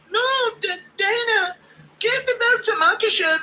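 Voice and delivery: very high, whiny pleading Ian Gary voice; Gary impression. Streamer mocking Gary begging Dana, high whiny voice. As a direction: beg in a high crying whine. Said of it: "impression of Gary to Dana".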